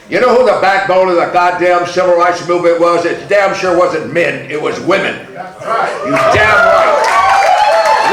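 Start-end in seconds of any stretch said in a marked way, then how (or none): 5.3-8.1 high, drawn-out laughing yes; laughing exclamation. Real, not acted. Praising the women of the movement, a high drawn-out laugh. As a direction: crack up as you insist.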